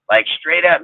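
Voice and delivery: ordinary delivery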